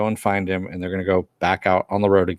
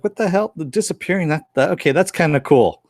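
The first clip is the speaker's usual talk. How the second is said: surprised Cassidy; 7 semitones higher than usual. Retold or imitated. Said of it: "And he's like".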